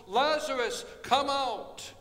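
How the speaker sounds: loud ringing call, high and strong; shout. Acted. Jesus calls Lazarus from the grave, full shout. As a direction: call the dead man out of the casket. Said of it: "he shouts".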